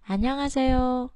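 안녕하세요 with 안 pitched low, then rising; low start on 안. Shown as a demonstration. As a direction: drop the voice on 안 in 안녕하세요, then lift. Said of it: "Mình xuống giọng chữ an".

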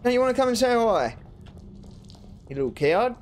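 taunting. Real, not acted. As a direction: goad the enemy who ran off. Taunting a fled enemy.

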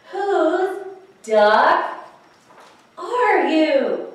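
high, loud, drawn-out yell; exasperated cry. Acted. Alligator skydiving with the duck, exasperated holler. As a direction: holler it with pauses, stretching each word.

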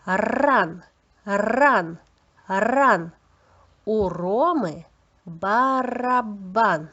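long trilled R in "Ран" and "барабан"; clear articulation. Shown as a demonstration. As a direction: drill "Ран, ран, ран", then draw out "барабан" slowly. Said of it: "Чітко вимовляйте, будь ласка, повторюйте".

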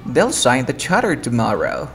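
"charter" gliding over the missing T. Shown as a demonstration. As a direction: model sentence with charter as "char-er". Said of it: "dirías".

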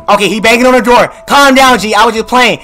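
high, loud, hurried pleading; panicked backpedalling. Real, not acted. Player pleading with whoever bangs on door, panicked shout.

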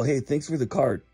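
ordinary talk, nothing marked

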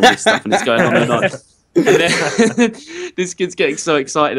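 laughing while telling it; words breaking up with laughter. Real, not acted. Laughing through funny story.

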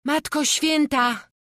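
loud, high, drawn-out exclamation; angry cry. A narrator's reading. Magda is outraged at talk of the devil, angry high cry. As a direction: cry out indignantly, high and slow. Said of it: "zawołała Magda ze złością".

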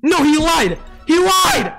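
high-pitched frustrated shouting; raging gamer. Real, not acted. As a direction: yell at the game in disbelief. Raging at the game.

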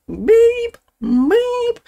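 high beeping imitation of the fire alarm; mock alarm beep. Streamer mimicking the fire alarm, high beeping. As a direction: chirp it like a smoke alarm. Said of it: "the whole entire video of a beep".

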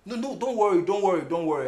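urgent, repeated promise; eager reassurance. Acted. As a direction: rush to reassure a lover. Hastily reassuring sweetheart.

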